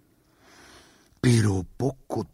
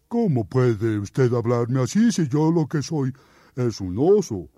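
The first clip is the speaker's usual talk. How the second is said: oso firme y sencillo; bear folk; 5 semitones higher, 4 dB louder than usual. Acted. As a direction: explica con calma que es oso. Oso incrédulo. (oso que protesta)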